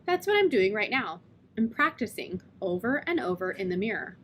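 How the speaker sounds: usual speaking voice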